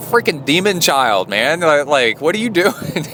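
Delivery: high-pitched, half-laughing scolding; mock exasperation. Rattled father to his son lurking by the bed, mock exasperation. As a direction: retell a rattled parent's protest, pitched well up.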